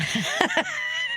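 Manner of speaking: high burst of laughter; a real laugh bubbling up. Real, not acted. Laughs at jujitsu invitation.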